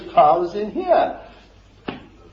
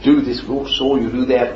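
lower, dismissive skeptic voice; mock doubter; 9 semitones lower than usual. Churchgoer shrugging off Jesus's works, lower and dismissive. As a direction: wave it off like an unconvinced listener. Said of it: "You say".

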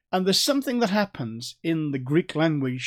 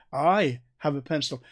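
stretched, stressed "I" that singles out the speaker; emphatic I. Shown as a demonstration. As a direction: lean on "I" to set yourself apart. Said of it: "And I'm distinguishing myself".

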